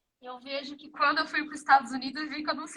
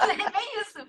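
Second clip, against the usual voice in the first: higher-pitched, amused agreement through laughter; laughing agreement; 7 semitones higher than usual. Real, not acted. Guest owning up to her language blunder to host, laughing. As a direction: agree while laughing at yourself.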